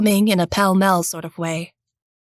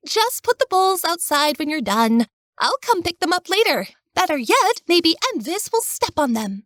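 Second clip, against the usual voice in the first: put-on high, bubbly teenage girl voice; cheery girl; 9 semitones higher than usual. A narrator's reading. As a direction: chirp it like a lively teenage girl. Jenna's cheeky parting words.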